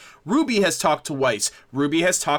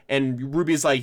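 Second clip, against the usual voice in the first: ordinary delivery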